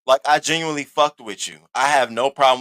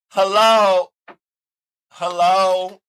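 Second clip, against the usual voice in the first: raised, higher call of hello; 5 semitones higher, 6 dB louder, much slower than usual. Real, not acted. Streamer calling to silent chat, higher and louder.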